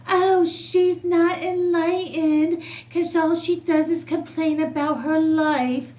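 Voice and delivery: high, slow, sneering mock of a judgy person; mocking whine. Vlogger mocking people who judge others, high sneer. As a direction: sneer it out like a smug know-it-all.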